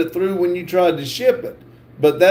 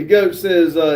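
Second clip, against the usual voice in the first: ordinary delivery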